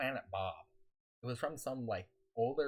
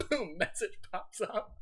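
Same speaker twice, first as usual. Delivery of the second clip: laughing; 8 semitones higher, 5 dB louder, slower than usual. Real, not acted. Laughing recounting surprise message.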